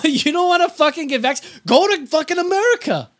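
high, hammed-up dismissive rant; mock presidential rant. Host acting out the president scolding vaccine refusers, high mock rant. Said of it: "'cause he says".

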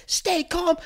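high, mocking repeat; furious manager echoing the cop, sneering high. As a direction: throw the words back in scorn.

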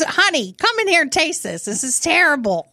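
hammed-up disgust, calling out from the kitchen; mock disgusted wife. Shopper calls her husband in to taste it, mock disgusted.

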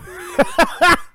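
squeaky burst of laughter; high laugh. Real, not acted. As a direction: giggle at the flub. Laughing at the bad accent.

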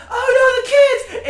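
high, loud panicked shriek; mock panic. Retelling a comedy scene to a friend, high loud panicked cry. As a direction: squeal it like a frantic aunt in alarm. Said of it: "and she's like".

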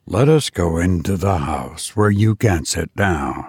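low, even machine voice; robot teacher voice. Acted. Robot teachers telling parents, low and mechanical. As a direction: speak like a calm robot.

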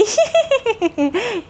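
high, scornful laugh; mocking laugh. A narrator's reading. Wind laughs off the sun's reply, high and scornful. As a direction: laugh him off. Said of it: "हवा हंसने लगी".

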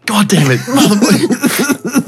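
laughing curse. Retold or imitated. Retold swearing at crashed car.

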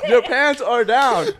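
high, loud, slow laughing disbelief. Retold or imitated. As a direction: ask it while cracking up. Retold shocked question, laughing.

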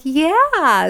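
proud mother agreeing; fond mom yes. Retold or imitated. Answering her son, fondly. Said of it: "And I'm like".

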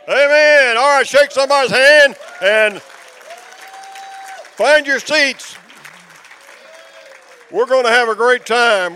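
high, loud, excited preacher call; loud rallying call. Real, not acted. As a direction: pump up the whole congregation. After prayer, rallying the room.

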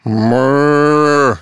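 long drawn-out cow moo. Acted. Joke teller voices a cow at heaven's gate, mooing. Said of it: "leans up next to Larry and goes".

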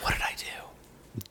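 slow unvoiced whisper. Real, not acted. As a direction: whisper it slowly. Puzzled aside to cohost.

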